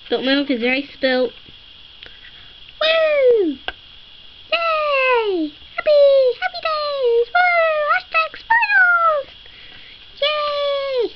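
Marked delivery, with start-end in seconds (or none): 2.8-11.1 acted cheering. Child acting out wild cheers for the winning toy. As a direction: whoop it up like a winning fan. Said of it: "I'm acting".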